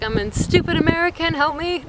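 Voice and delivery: high, self-mocking plea; retold helpless plea. Lost American begging a stranger for help, self-mocking plea.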